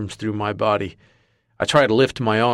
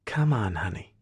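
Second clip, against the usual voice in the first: purring; 6 dB quieter than usual. Acted. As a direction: coax him, softly, like a cat. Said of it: "a feminine voice purrs".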